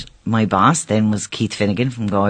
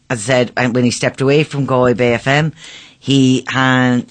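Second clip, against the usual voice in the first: ordinary talk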